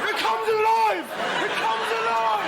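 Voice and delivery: high, drawn-out wail; mock alarm cry. Drunk man seeing his vomit alive, mock horror.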